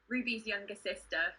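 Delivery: usual speaking voice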